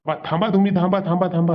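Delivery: high, loud repeated order to stop; angry call to halt; threatening shout. Acted. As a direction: shout after the culprits. Owner calls after caught thieves.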